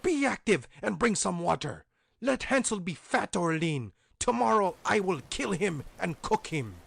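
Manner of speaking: high, loud commanding witch; impatient witch. A narrator's reading. Witch ordering Grethel, high loud impatient voice. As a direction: order her about like a cruel witch.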